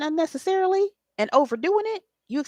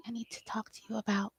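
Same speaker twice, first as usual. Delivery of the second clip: whispered, barely voiced and much softer; timid whisper; 13 dB quieter, faster than usual. A shy young girl whispering that she needs to talk, whispering timidly. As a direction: shrink down and whisper the request. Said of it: "I would whisper".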